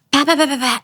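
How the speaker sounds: gunfire sound. Retold or imitated. Retelling hearing gunshots nearby, imitated pops. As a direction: pop out the shots like distant gunfire. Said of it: "and I hear guns".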